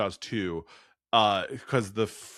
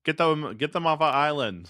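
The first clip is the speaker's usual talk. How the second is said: mock Boston local, a bit higher; bad Boston accent, half-hearted; 7 semitones higher than usual. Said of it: "that was my Boston accent".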